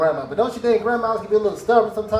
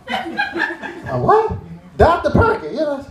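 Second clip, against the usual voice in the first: incredulous waitress; 9 semitones higher, much slower than usual. Retold or imitated. Waitress puzzled reply.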